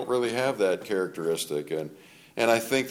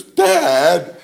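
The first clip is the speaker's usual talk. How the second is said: indignant shout; 9 semitones higher, 13 dB louder than usual. Retold or imitated. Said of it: "She goes".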